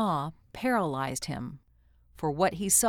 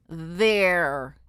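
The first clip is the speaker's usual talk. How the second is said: loud alien babble; creature voice; 6 dB louder than usual. A narrator's reading. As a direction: blurt it out like a strange creature. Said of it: "it babbled".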